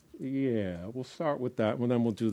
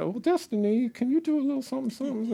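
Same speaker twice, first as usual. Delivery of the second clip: mimicking a request; 14 semitones higher, faster than usual. Mimicking people asking her.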